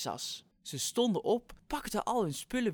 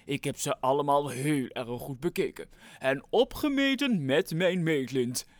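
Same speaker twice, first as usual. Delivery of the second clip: megaphone announcement; 10 dB louder than usual. Acted. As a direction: announce the winners with suspense. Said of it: "begon weer te roepen door de megafoon".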